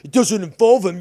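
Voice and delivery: shouting down an accusation; angry shout. Real, not acted. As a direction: bark it back at him furiously. Repeats line yelling at S1.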